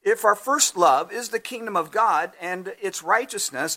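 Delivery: ordinary talk, nothing marked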